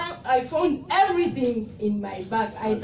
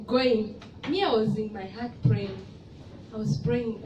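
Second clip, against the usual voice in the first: normal talking voice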